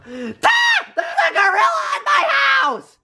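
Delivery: high, panicked shriek at the gorilla; shrieking in terror; terrified scream. Acted. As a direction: scream it in pure fright. Carl sees the gorilla.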